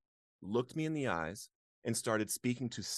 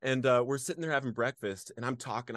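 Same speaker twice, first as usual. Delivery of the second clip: ordinary talk, nothing marked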